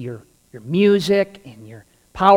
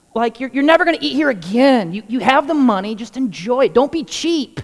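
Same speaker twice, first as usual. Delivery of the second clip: insistent brother; 7 semitones higher than usual. Retold or imitated. Brother urging on phone.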